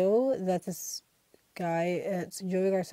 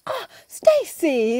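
much higher, slow, louder mock voice; mock exasperated gamer; 10 semitones higher, 8 dB louder, much slower than usual. YouTuber finding chickens in his room, high drawn-out mock voice. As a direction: drag out the name in fake dismay.

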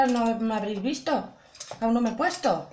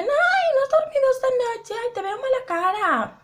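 mock concerned acquaintance; 11 semitones higher than usual. People telling her she looks tired.

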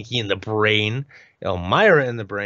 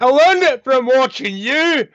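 high, loud, drawn-out cry; hammed-up dramatic confession; mock outburst; 6 dB louder, slower than usual. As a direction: wail the punchline slowly. Acted punchline reply in retold exchange.

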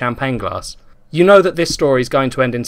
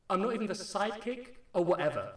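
much higher, far-off yell from down the hall; yell from afar; 9 semitones higher, 15 dB quieter than usual. A narrator's reading. Liam yelling from the hallway, far off and higher. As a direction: shout it from another room. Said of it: "Liam yell from the hallway".

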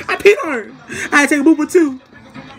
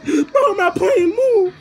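high, loud pleading through tears; tearful pleading; 12 semitones higher, 4 dB louder, slower than usual. Retold or imitated. Boy pleading with his sister to move from the toilet. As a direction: beg to get in, almost crying. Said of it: "I'm already in tears".

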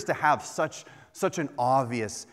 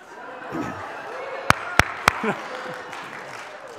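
breathy laugh; 3 semitones lower than usual. Real, not acted. Laughs at man flu study.

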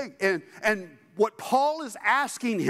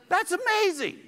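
much higher, rising with wonder; excited; 11 semitones higher than usual. Real, not acted. Preacher to congregation, thrilled by freedom, excited. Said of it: "something to get excited about".

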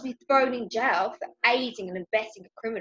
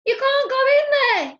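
high, very loud panicked yell; 10 dB louder than usual. A narrator's reading. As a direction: yell to stop him in his tracks. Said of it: "yelled Ben".

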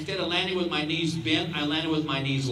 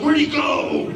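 confused cheetah; 9 semitones higher, 7 dB louder, slower than usual. Retold or imitated.

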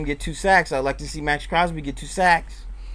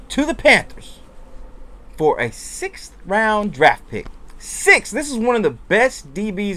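high, loud disbelief at the cheap trade; incredulous outburst; 8 semitones higher, 3 dB louder than usual. Real, not acted. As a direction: shout it like you can't believe the price.

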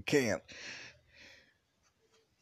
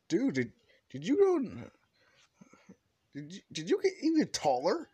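much higher, amazed bro voice; Kirishima voiced; 10 semitones higher than usual. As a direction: gush at him, pitched high. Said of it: "walks up saying".

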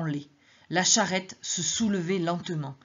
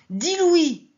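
raised offer; 11 semitones higher, 8 dB louder than usual. Acted.

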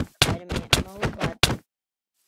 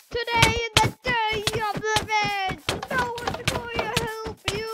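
mock villain; 11 semitones higher than usual. Threatens mobs as game villain.

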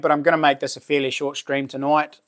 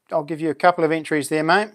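usual speaking voice

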